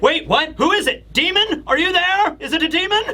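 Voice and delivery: high, panicky squeaks of alarm; startled Luffy. Retold or imitated. Luffy hearing a mystery voice, panicky and high. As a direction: jump in pitch, spooked and confused. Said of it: "Luffy's like".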